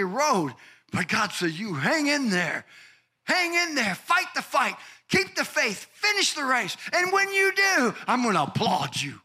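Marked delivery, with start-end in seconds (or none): 1.5-9.2 shouted encouragement. Acted. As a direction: call them on like a coach at the finish line. Voicing God's encouragement.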